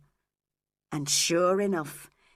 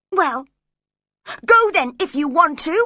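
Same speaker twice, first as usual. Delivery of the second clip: much higher, louder defiant cry; 9 semitones higher, 10 dB louder than usual. A narrator's reading. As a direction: fling the words at him. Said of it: "she cried".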